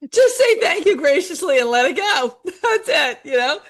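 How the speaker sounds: high-pitched, talking through real laughter; amused, laughter spilling into the words; laughing. Real, not acted. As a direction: give the advice while cracking up. Both laughing at the mint joke.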